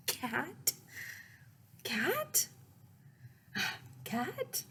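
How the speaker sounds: high pleading cat calls, rising each time; begging for a cat. Acted. Child begging parents for a cat, high pleading calls. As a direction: plead like a child who wants a cat badly.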